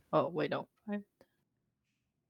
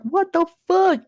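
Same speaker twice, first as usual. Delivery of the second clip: loud, high startled cry; alarmed reaction; 9 semitones higher, 5 dB louder than usual. Acted. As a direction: burst out in mock alarm. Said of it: "everyone is alerted, and they're like".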